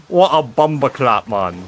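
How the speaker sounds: laughing mock London roadman voice; south london slang voice. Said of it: "feels really offensive when I say that".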